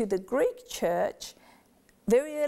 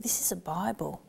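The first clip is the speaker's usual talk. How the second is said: reverent hushed whisper; child whispering to her sister about the Bible; 3 semitones lower, 5 dB quieter than usual. Retold or imitated. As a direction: breathe it out like a secret. Sisters near Dad's sacred Bible.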